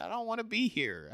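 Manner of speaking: high-pitched, fed-up; high exasperated. Retold or imitated. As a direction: squeal your frustration. Venting to coworker about LA.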